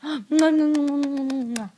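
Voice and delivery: string of loud smacking kisses; rapid kisses. Shown as a demonstration. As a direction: pepper the doll with kisses.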